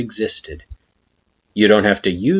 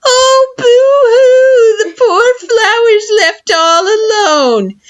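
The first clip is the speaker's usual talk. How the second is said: very high, loud, hammed-up fake crying; mock sobbing in a sing-song lilt; sing-song mockery; 8 dB louder, much slower than usual. A narrator's reading. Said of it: "He puts on a sing-song voice".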